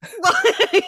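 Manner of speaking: high, loud real burst of laughter. Real, not acted. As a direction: crack up loudly. Reacting to cat story.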